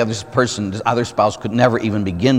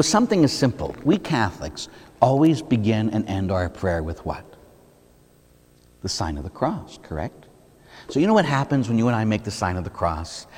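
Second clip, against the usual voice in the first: normal talking voice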